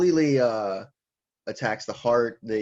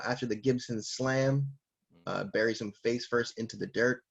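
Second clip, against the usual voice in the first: usual speaking voice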